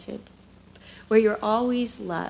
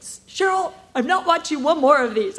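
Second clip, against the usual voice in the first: exasperated husband; 3 semitones higher, 6 dB louder than usual. Retold or imitated. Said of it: "and he's like".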